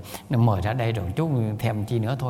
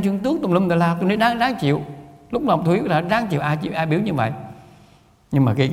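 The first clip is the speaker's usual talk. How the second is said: usual speaking voice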